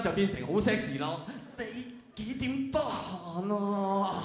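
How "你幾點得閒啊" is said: slow, strained moaning voice, drawn right out; sexy moan. Acted. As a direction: moan it out as if strangled by the phone cord. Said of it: "發出嗰種呻吟嘅聲音，就變成好sexy咯".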